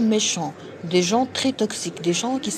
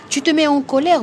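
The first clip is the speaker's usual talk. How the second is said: raised scolding; 6 semitones higher, 6 dB louder than usual. Pretend. Mock addressing an angry person.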